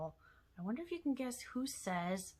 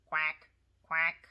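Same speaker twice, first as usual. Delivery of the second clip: duck quack; 5 semitones lower, 4 dB louder than usual. Acted. Puppet duck says its sound.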